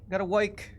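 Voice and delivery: "work" said as "woik", New York style; New York pronunciation. Shown as a demonstration. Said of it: "gotta work on my New York accent".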